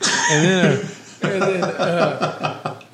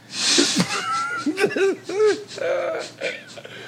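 high, long helpless giggle; helpless laughing fit; 4 dB quieter than usual. Real, not acted. Cracking up mid-story about Ray, helpless high giggle. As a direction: break up laughing before getting the words out.

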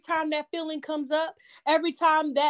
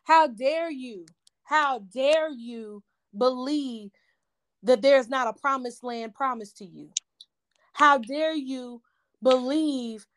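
slow, emphatic sermon cadence; measured preacher's challenge, each "how dare you" drawn out; preaching; 3 semitones lower than usual. Acted. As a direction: preach it slowly, daring the listener to doubt. Preaching to listeners, repeated challenge.

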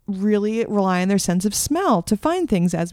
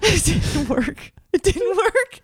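laughing; 10 semitones higher, slower than usual. Real, not acted. Laughing repeat of punchline.

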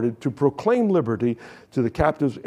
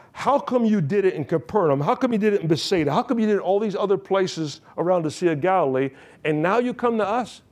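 resentful challenge; 6 semitones higher than usual. Acted. Crowd challenging Jesus.

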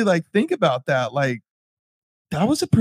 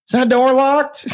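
lady's voice; 10 semitones higher, 4 dB louder than usual. Retold or imitated. Lady asking, voiced by teller.

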